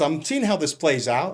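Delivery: plain reading voice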